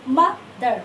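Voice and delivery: Mother in two beats, parted after মা. Shown as a demonstration. Teacher summing up for students, mother pronounced in two separate syllables. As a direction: split mother with a short pause. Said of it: "দুবারে উচ্চারণটা হচ্ছে".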